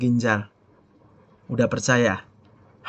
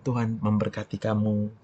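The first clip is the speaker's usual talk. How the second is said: soft, lowered, tender reply; gentle blessing; 5 semitones lower, 8 dB quieter than usual. Acted. Wife calming an angry husband, soft and gentle. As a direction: bless them softly to calm them. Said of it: "Jawaban yang lemah lembut".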